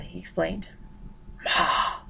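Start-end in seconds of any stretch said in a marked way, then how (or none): 1.4-2.0 a breathy whispered sigh. Acted. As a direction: sigh the word out wearily. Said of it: "Mother sighed".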